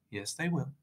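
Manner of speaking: quietly unruffled answer; calm contradiction. Acted. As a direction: contradict softly and lightly. Calm contradiction of outraged vampire.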